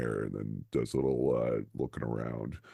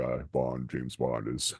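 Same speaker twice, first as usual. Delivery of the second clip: ordinary delivery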